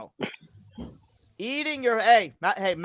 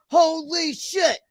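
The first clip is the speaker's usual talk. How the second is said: fans yelling; 6 semitones higher than usual. A narrator's reading. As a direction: chant it like a wrestling crowd. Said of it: "The fans start yelling".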